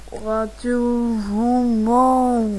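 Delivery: very slow, stretched radio announcer name; drawn-out announcer. Acted. Host announces the pretend station's name, very slowly and drawn out. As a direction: stretch the show name like a jingle.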